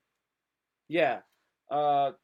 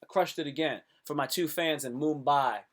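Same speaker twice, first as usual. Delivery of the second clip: ordinary talk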